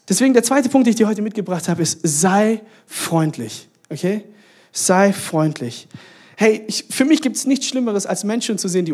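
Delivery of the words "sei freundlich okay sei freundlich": drawn-out key point, word by word; slow, deliberate. Real, not acted. Preacher gives congregation the second point, slow and deliberate. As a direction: land each word slowly and firmly.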